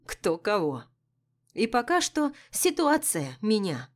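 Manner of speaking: plain reading voice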